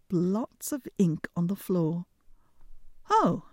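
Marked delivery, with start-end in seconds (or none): 3.1-3.5 high loud sudden oh; a bright startled oh; surprised oh. A narrator's reading. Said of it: "said Clumsy Hans".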